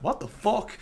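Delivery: high, softened bewildered question; hushed, high-pitched disbelief; baffled inquiry. Retold or imitated. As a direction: ask it like a puzzled dog cocking its head. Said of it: "inquiring at an animal".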